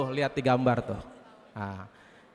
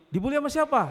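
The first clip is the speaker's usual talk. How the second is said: higher-pitched mother's question; concerned mother; 10 semitones higher, faster than usual. Acted. Mother questioning her bullied child, high and concerned. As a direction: ask as a startled mother, pitched well up. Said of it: "lapor sama ibunya".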